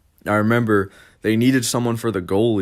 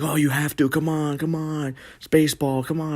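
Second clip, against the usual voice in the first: higher, coaxing parent voice; mock parents; 7 semitones higher than usual. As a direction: lightly imitate nagging parents. Parents pushing him to go.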